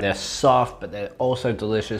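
chewing while talking; speaking between bites of pie; mouth full. Real, not acted. Seen 1: Bites pie at -1.0; mouth likely still has food while talking.

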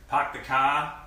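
put-on thick Boston accent, dropped Rs. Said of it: "Do a Boston accent".

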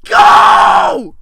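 high, loud drawn-out yell; panicked shout. Real, not acted. Player urging the character on, panicked shout. Fleeing in game.

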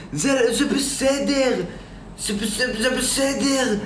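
high put-on voice saying it's fine; squeaky reassurance. Streamer comforting his chat in a high childlike voice. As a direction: play a small boy soothing the chat. Reassuring viewers before enlisting.

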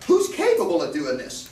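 ordinary talk

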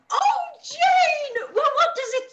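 high, loud, breathless excitement with a laugh; excited squeal. Acted. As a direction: gush over the letter like an eager mother. Mother excited over letter.

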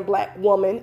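ordinary delivery

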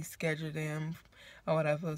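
normal talking voice

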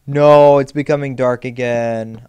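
loud dismayed no; alarmed. Real, not acted. Gamer dismayed loudly as night falls in game. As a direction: blurt it out as night comes.

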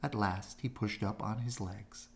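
ordinary delivery, nothing marked

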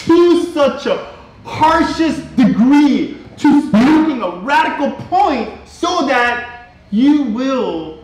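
slow, high, swelling sermon climax; impassioned pulpit warning; preaching at full force. Acted. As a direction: build the warning to a peak, drawn out.

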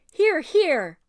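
loud, high shout of approval; hearty cheer. A narrator's reading. Sailor backs the mouse's speech, hearty shout. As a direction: call it out from the crowd.

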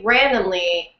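usual speaking voice

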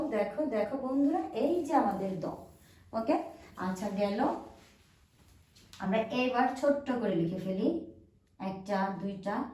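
ordinary talk, nothing marked

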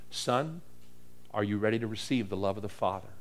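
put-on soft and low, a kindly old minister; gentle question. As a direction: ask it tenderly, almost hushed. Joe's tender question, retold softly.

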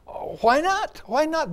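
high, loud rhetorical question; acted sudden realization. Preacher reacting to talk of church troubles, exclaimed realization. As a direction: exclaim it as if it just dawned.

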